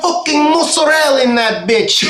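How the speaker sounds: high, hammed-up Philly accent. Riffing as the waiter offering queso, hammed-up Philly accent. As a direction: exaggerate a brash Philly waiter. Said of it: "a Philly accent".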